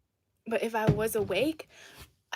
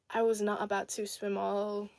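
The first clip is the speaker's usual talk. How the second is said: ordinary delivery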